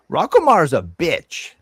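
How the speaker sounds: sassy doll insult; talking doll. Acted. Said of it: "She says".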